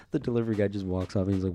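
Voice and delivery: ordinary talk, nothing marked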